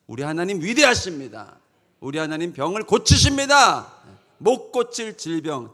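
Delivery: loud preacher's declaration; proclaiming. Shown as a demonstration. Preacher shows congregation what evangelism is, loud proclamation. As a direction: declare it with full force. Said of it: "전도는 선포하는 거예요".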